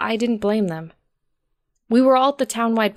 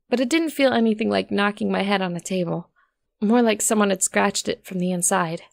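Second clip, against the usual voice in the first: ordinary delivery, nothing marked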